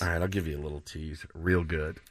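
low, soft teasing aside; hushed tease. Pretend. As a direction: drop right down for a secret little teaser. Playing up the requested tease.

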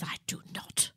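clipped whispered retort; whispered denial. Retold or imitated. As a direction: deny it under the breath. Retold Queen denying favourite.

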